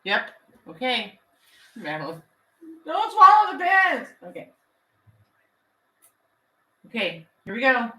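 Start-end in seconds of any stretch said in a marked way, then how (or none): 2.9-4.1 higher, louder call-out; loud warning. Real, not acted. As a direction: shout the warning across the room. Calling a warning to Madeline.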